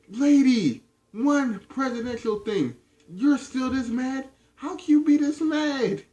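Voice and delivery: high, disbelieving mock protest; incredulous. Narrator's silent retort to angry Samantha, high incredulous mockery. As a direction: act baffled at how mad she still is. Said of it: "I'm sitting there like".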